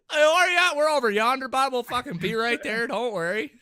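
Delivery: high, loud put-on buddy voice; mock-reassuring reply. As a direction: call back over comms in a hammed-up voice. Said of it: "I hit the talk key without even realizing it".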